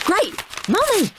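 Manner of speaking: put-on high-pitched pleased child; beaming delight. A narrator's reading. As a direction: say it with a big grin. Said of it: "said Henry, beaming".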